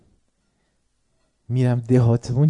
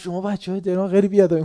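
raised voice; 6 semitones higher, faster than usual. Retold or imitated. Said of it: "صداش بود بالا".

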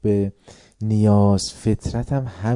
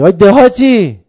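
higher, much louder jeering call; mock taunting shout; 8 semitones higher, 14 dB louder, slower than usual. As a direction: yell the insult like a village taunt. Mock insult shouted at audience.

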